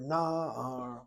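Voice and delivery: na'ar slowly, as NAA-ar; long first syllable in na'ar, light ending; stress on first syllable. Shown as a demonstration. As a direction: stretch and accent the NA of na'ar. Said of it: "demands the accent".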